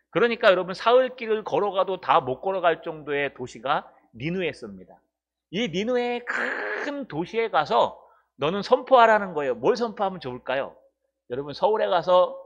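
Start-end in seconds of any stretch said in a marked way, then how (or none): none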